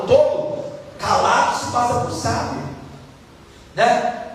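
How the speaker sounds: ordinary talk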